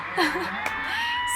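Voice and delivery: a high, squealing burst of laughter; shrieking laugh. Real, not acted.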